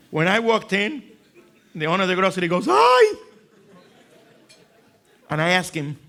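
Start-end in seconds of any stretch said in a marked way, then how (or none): 2.7-3.1 very high, loud squeal of a hi; excited hi. Retold or imitated. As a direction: greet him like a long-lost friend. Grocery owner's overjoyed greeting.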